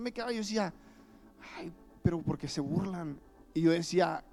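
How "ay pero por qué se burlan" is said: soft, hushed sorrowful aside; soft hurt thought. Retold or imitated. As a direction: quietly grieve for the mocked boy. Child's inner grieving thought. (lamento suave y callado del niño)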